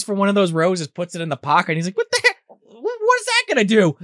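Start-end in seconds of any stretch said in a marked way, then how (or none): 2.0-4.0 very high squeaky put-on voice; mock bafflement. Candidate puzzled by the trick flower, very high. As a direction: squeak it out in disbelief.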